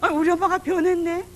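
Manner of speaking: high, loud, drawn-out astonishment; mock amazement, pitch climbing high; startled son. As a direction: imitate a son amazed at his mother. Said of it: "우리 아들이 지가 놀랬어".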